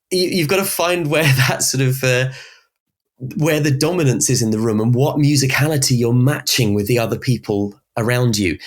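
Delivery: ordinary delivery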